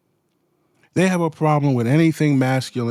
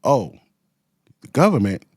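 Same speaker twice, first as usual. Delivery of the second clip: usual speaking voice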